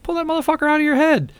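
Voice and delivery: squeaky high blurt, fast; high mock yell. Telling his wife on the phone, high squeaky mock yell. Blunt advice in retold call.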